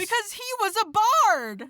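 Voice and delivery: high loud punchline delivery. Acted. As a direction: land the pun with glee. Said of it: "And he goes".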